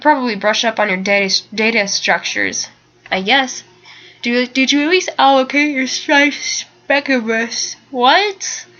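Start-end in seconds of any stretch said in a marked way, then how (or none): 4.2-8.7 words stretched by a real yawn; slow, higher speech as a yawn takes over. Real, not acted. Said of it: "I'm sorry, I keep yawning".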